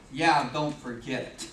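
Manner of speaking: low, slow cocky warning; smug lion. Acted. Lion putting the giraffe in its place, smug. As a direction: drawl it low and self-satisfied.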